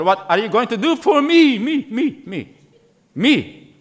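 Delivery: hammed-up selfish demand; mocking selfish voice. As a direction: parody greedy churchgoers with a laugh. Mocking self-centred churchgoers.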